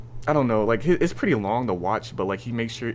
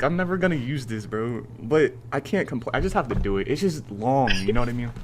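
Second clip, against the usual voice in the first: normal talking voice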